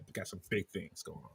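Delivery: whispered big things line; whispered Roger. Acted. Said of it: "Roger from American Dad".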